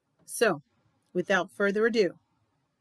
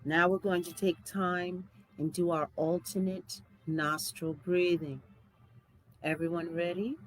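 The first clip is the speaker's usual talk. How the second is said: guided meditation; 4 dB quieter than usual. Acted. Host leading listeners through breathing and spoken statements, guided meditation. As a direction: guide them calmly through each breath.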